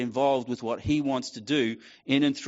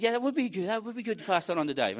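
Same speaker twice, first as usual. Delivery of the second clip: higher, casual mock yeah; retold agreement; 9 semitones higher, faster than usual. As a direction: agree offhandedly with yourself. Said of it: "And I said".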